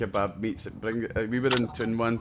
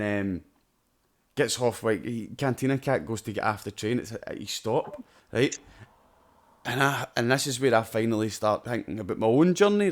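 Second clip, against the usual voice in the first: normal talking voice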